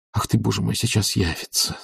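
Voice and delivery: soft worried aside; hushed inner panic; anxious thought. A narrator's reading. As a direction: fret under your breath. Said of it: "думал Обломов".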